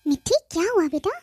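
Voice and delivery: ordinary talk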